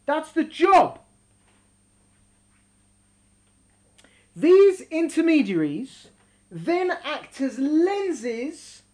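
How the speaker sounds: slow, measured teaching read; emphatic reading aloud. Acted. Teacher reading a text on prophecy to students, deliberate emphatic reading.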